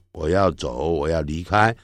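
ordinary talk

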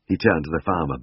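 ordinary delivery

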